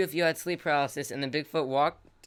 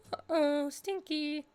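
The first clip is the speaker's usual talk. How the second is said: high squeaky uh-oh; slower than usual. Real, not acted. Forgot torches in cave.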